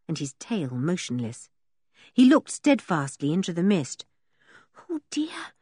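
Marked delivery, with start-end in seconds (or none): 4.9-5.5 high, anxious whisper; hushed, worried little girl's voice. A narrator's reading. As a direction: whisper it fretfully. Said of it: "whispered Anne".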